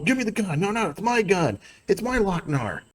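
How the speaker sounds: high-pitched mock squabble over a weapon; two people arguing. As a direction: act out a yelled argument without raising volume. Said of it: "they're about to start arguing".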